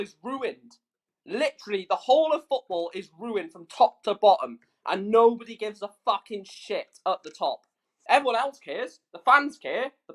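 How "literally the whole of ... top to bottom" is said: ordinary talk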